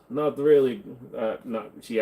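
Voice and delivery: ordinary delivery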